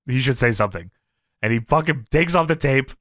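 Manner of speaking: ordinary talk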